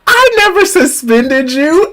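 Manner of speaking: put-on high, gushing old teacher. Teacher gushing over her grown-up former student. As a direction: coo over him like a doting teacher. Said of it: "And she said".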